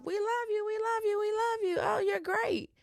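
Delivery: squeaky, sing-song fake adoration; high, sugary imitation of fake love; high mock gushing. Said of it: "It start off".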